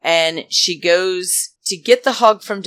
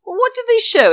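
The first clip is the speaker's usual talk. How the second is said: shoots way up, loud and outraged; scandalised, can't believe it; indignant disbelief; 5 dB louder, much faster than usual. Real, not acted. Outraged at the production's clip choice.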